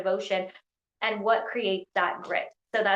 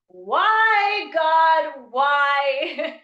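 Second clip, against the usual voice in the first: high, loud sung wail to the heavens; hammed-up anguished cry at God; mock despair; 7 semitones higher, 9 dB louder, much slower than usual. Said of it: "you're on your knees and you're like".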